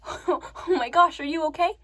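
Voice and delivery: high, acted gasp of worry; panicked concern. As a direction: check on someone just hit, alarmed. Retold panicked check on victim.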